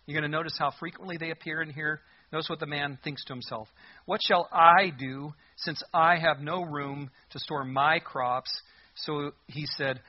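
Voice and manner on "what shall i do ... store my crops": slow reading, "I" and "my" hit hard; stress on I and MY. Shown as a demonstration. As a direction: punch every "I" and "my", taking it slowly. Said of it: "I wanna emphasize the words".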